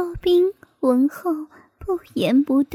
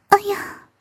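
higher-pitched exclamation of surprise; startled cry; 4 semitones higher than usual. A narrator's reading. Both characters startled at once, a short startled cry. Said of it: "两人同时叫出声来".

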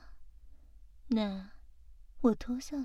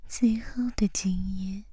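right in your ear. Acted. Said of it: "贴着你的耳朵说话吧". (贴耳低语)

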